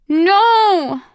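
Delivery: very high, loud anguished scream. Acted. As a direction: scream it in raw denial. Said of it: "Ona screamed".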